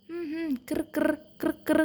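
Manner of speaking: soft throaty grumble sounds; a quiet mimicked growl from under the steps; grumbling noise. Puppy imitates the mystery grumble, soft and throaty. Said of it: "ഒരു മുറുമുറുപ്പ്".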